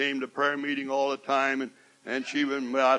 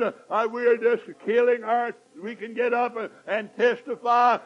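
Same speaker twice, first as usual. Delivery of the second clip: loud, higher impassioned preaching; preaching at full force; 10 semitones higher, 6 dB louder than usual. Acted. Preacher rebuking murmuring believers, loud and fervent. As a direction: drive it home to the congregation.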